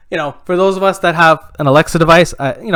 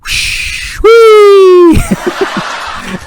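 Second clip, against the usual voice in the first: long whoosh into a high loud squeal; imitated toilet flush; 7 dB louder than usual. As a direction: mimic a flush, ending sky-high.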